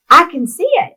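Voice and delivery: high, loud retold cry of joy; joyful exclamation. Daughter imitating her laughing father's vision, joyful exclamation. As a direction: call it out high with delight, imitating him. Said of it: "And he's laughing".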